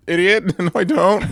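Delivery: high, loud, laughing protest; flustered denial. Retold or imitated. Kid teased by a buddy about a girl, high loud laughing denial. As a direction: deny it hard, embarrassed. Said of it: "You're like".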